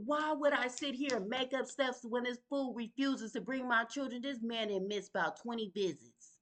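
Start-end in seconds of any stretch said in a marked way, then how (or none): none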